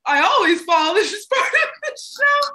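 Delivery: high, loud and laughing, pretending the fall was planned; laughing through embarrassment after a stage fall; laughing cover-up. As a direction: brush it off through giggles. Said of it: "I'm trying to be like".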